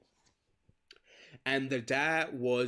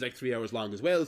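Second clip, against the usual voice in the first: ordinary talk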